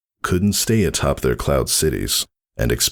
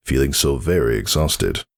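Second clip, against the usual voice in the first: ordinary delivery, nothing marked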